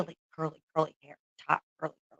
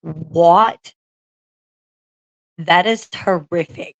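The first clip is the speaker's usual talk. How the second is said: a loud, rising "What?" then a slow stunned follow-up; real horrified surprise; shocked; 8 dB louder, much slower than usual. Real, not acted. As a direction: react in real disbelief, punching the first word. Shocked reaction to a chat story.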